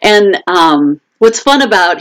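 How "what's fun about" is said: ordinary talk, nothing marked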